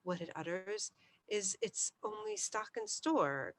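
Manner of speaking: plain reading voice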